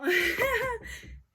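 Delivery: short high burst of laughter; laughing. Real, not acted. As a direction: laugh at your own slip. Laughs at her own slip.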